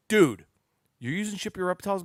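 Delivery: loud, high retold scolding; mock yell. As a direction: bark it like an annoyed friend. Friend Jeff scolds him on phone.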